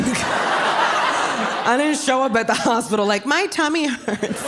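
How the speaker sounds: slow, high, laughing little whine; laughing mock innocence. At the hospital, clueless: slow high laughing whine. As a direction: play clueless, laughing through it. Joking about unnoticed pregnancy.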